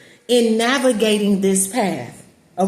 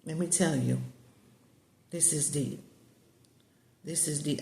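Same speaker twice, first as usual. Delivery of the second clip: hushed awe; 5 semitones lower, 13 dB quieter than usual. Real, not acted. Telling her viewers about the prophetess, hushed and much softer. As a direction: drop much softer, almost under the breath.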